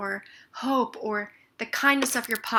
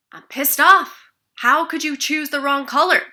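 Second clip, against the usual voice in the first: loud, higher, furious demo at the painters; angry outburst; 5 semitones higher, 14 dB louder than usual. Shown as a demonstration. Demoing rage at the painters, loud and furious. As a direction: blow up like you're on the phone with them. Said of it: "let's say that I'm upset".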